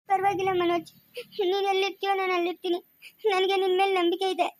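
weepy declaration of trust; crying, pleading voice; tearful. Acted. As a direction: reassure him through tears. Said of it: "ಯಾಕೆ ಅಳ್ತಿರೋದು ನೀನು".

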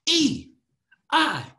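high, punched vowel calls; big high bursts, one letter at a time; loud. Shown as a demonstration. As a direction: shout each letter separately, falling sharply. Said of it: "We're gonna go loud and fast".

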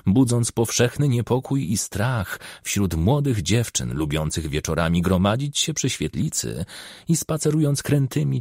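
usual speaking voice

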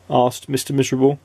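ordinary delivery, nothing marked

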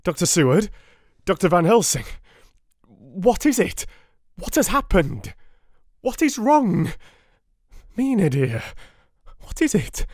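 high, loud panicked questions; pleading in rising terror; frantic panic. A narrator's reading. Husband begging the doctors for answers, panicked and high.

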